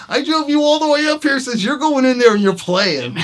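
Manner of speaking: loud, high-pitched exasperated order; insistent friend. Retold or imitated. Friend who drove him up ordering him inside, loudly insistent.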